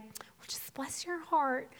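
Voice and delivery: soft, slow, sweet Southern drawl; Debbie's sweet Southern accent. Retold or imitated. Counselor comforting her client, sweet Southern accent. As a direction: imitate a sweet Southern accent, drawn out and hushed. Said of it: "this sweet Southern accent".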